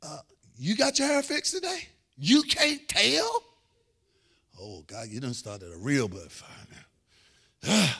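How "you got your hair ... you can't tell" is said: high, drawn-out wounded question; needling spousal questions. Acted. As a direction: act out a touchy marital exchange, pitched up. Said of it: "You can do her the same way".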